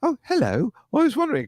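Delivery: put-on high, prim British kid voice; mock British accent. Teasing a British classmate from kindergarten, mock British accent. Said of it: "he's had a British accent".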